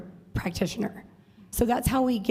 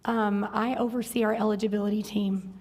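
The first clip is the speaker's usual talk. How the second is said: ordinary delivery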